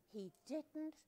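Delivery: ordinary delivery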